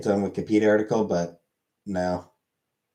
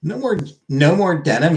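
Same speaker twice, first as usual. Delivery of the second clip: higher and louder, incredulous rise on "more"; mock outrage; 6 semitones higher, 4 dB louder than usual. Streamer to chat, echoing the comment in mock outrage. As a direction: echo "no more" in mock disbelief, "more" lifted up. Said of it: "I am offended".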